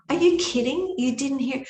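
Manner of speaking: high, loud disbelieving question; stunned she missed it; astonished. Retold or imitated. Group asking the leader, astonished and loud.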